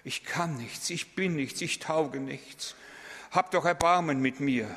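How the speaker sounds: high, pleading self-pity; falsely humble. Pretend. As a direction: whine like a false penitent. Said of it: "erniedrigen sich in einer falschen Weise". (hohes, jammerndes Selbstmitleid)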